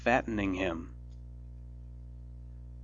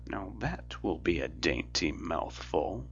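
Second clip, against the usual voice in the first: softer muttering witch, savouring; muttered; 3 semitones lower, 7 dB quieter than usual. A narrator's reading. Witch eyeing sleeping children, soft greedy mutter. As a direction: murmur it to yourself with greed. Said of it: "she muttered to herself".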